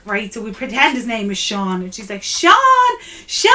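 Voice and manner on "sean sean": calling out sharply. Retold or imitated. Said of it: "like this".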